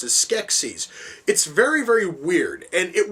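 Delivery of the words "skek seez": Skeksis ending in a long EE and Z; pronounced SKEK-seez. Shown as a demonstration. Reviewer showing the name near film's end, Skeksis as SKEK-seez. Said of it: "it once more is being pronounced".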